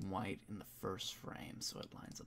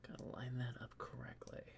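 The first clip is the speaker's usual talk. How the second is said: whispering to himself; 14 dB quieter, faster than usual. Real, not acted. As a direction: murmur under your breath as you work. Mutters to himself while editing.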